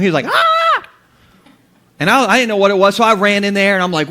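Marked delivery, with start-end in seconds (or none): 0.3-0.9 high-pitched held "ah" scream; imitated boy's yell. Son yelling from the bedroom, high imitated scream. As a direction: mimic a kid yelling out. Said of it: "he yelled from my bedroom".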